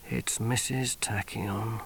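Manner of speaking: slow, hushed whisper; name her under your breath, slowly. Acted. Sergeant naming Missus Tachyon, slow whisper.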